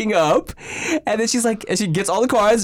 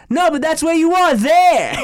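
higher, louder, silly movie voice; quoting Austin Powers; 14 semitones higher, 6 dB louder than usual. Retold or imitated. Teen at Six Flags trolling a stranger, loud goofy movie quote. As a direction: ham up the nonsense line. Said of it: "I'm like".

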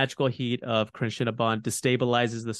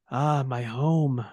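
slow wistful sigh; slower than usual. A narrator's reading. Dying dwarf relaxes.

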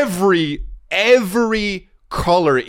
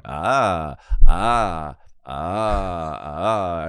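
mock teacher grunts; 6 semitones lower, much slower than usual. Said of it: "And he was going".

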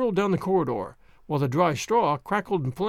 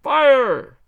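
loudest, higher shout of fire; all together, a little louder, fire; louder yell; 7 semitones higher, 9 dB louder than usual. Acted. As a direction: bellow fire, louder still. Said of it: "a little louder".

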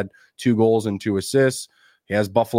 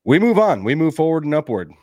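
upbeat; 6 semitones higher, 5 dB louder than usual. Real, not acted. Pumping himself up after illness.

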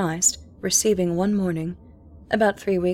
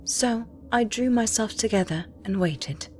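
ordinary delivery

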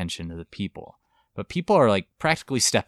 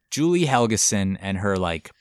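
normal talking voice

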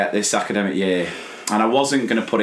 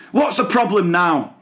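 higher-pitched weary teacher question; mock exasperation, pitched up; exasperated teacher; 8 semitones higher than usual. Teacher answering Tommy who keeps calling her name, exasperated.

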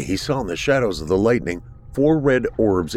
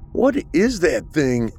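higher, slow awed fear; frightened question; 8 semitones higher, slower than usual. A narrator's reading. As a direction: stare up at it, scared. Arthur frightened by creature.

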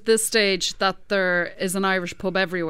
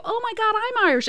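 excited tourist; 12 semitones higher, faster than usual. Retold or imitated. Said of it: "people go".

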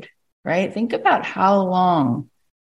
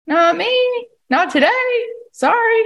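much higher, playful sing-song refusal; mock defiant refusal; slower than usual. Listener imitating someone waking up to refuse the program, in a high mock voice.

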